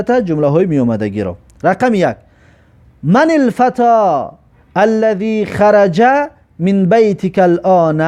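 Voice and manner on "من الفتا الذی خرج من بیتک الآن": slow recitation. Shown as a demonstration. Teacher recites Arabic exercise line.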